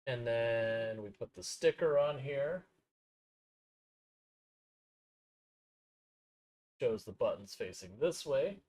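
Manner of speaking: muffled, off-mic and distant; talking away from the mic. Real, not acted. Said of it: "I do apologize for it being a little bit muffled".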